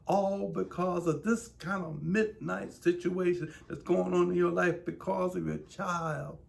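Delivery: loud, high fervent preaching; fiery sermon to struggling parents; preaching at full force. Acted. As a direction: drive it home to the parents. Preacher addressing troubled parents.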